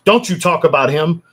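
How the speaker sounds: high, loud imitation of an outraged fan; mock defensive fan. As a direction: snap back like a fan defending his idol.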